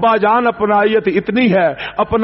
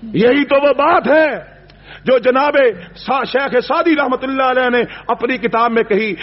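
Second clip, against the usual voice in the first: ordinary delivery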